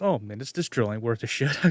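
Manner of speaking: soft, low mock grumble; offhand quiet complaint about the drill; dad's grumble. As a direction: mutter it like a let-down dad. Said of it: "And he goes".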